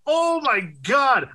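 high, drawn-out oh my God; delighted shout. Real, not acted. Recalling the Tubi ad with a friend, excited drawn-out shout. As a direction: stretch it out in delight. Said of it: "I loved that".

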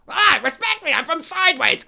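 high, loud hammed-up yell; mock-indignant shout; Paul Giamatti. Shown as a demonstration. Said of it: "That'll be Paul".

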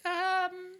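high, hesitant put-on voice; voicing Roger. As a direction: play Roger as timid and unsure. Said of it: "Roger's like".

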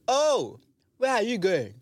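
high, loud teasing call; shouting sister. Retold or imitated. Sister shouting from car retold.

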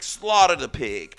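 ordinary talk, nothing marked

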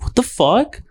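high-pitched retold outrage; shocked disbelief. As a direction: sound stunned that it's morning. Shocked at vodka in drink.

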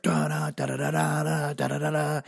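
sung-out da-da band noise; imitated guitar riff. Storyteller imitating the band cranking up, mouthed riff. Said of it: "they just start cranking up".

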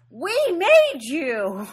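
loud, slow mock scream. Waitress screaming at the trucks, a loud slow mock scream. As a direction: yell it slowly at the trucks. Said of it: "And she starts screaming".

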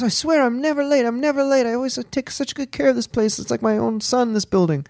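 high-pitched, insistent mock Italian accent. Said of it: "And he goes".

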